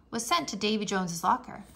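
ordinary delivery, nothing marked

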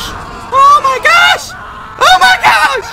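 shocked shriek. Real, not acted. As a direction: shriek it in panic. On fire, no powers.

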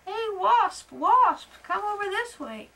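loud high call across the room. Acted. Beetle calls the wasp loudly toward the open door. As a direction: shout to get the wasp's attention. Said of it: "called to the wasp".

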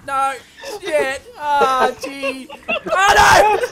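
loud, high desperate pleading; frustrated yelling. Real, not acted. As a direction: yell at the sliding character. Character slides off the level.

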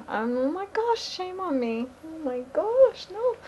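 high, embarrassed squeal of dismay; flustered oh my gosh. Real, not acted. Learner forgetting a word, high embarrassed squeal. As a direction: squeal at your own blunder.

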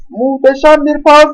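high, loud commentator shout; excited goal call. Acted. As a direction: call the equaliser with a rising thrill.

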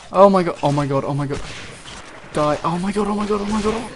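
frantic, rising panic repeated; panicking as enemies close in; panicked. Real, not acted. Panicked under fire in game.

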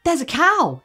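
put-on high eager child voice; excited little boy. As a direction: chirp it like an excited little kid. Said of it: "he would say".

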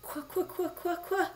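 high demand into rapid stutters; acted cross, freezing queen; angry, shivering. A narrator's reading. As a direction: demand it through a freezing stammer. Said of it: "She shivered and shook on her throne".

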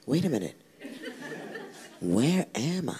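hushed and half laughing, bewildered; soft puzzled wonder. Retold or imitated. Mister Happy finding himself in Happy Land, hushed and bewildered. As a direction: murmur it as if waking somewhere new. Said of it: "He's like".

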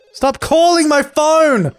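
high, slow, stretched-out yell; exasperated shout at the ringing phone; frustrated yell. Real, not acted. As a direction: drag the words out in a loud wail at the phone. Yelling at Sims in game.

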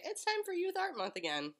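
high sing-song prompt; prompting line. Shown as a demonstration. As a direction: coax it out in a high lilt. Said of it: "Can you say".

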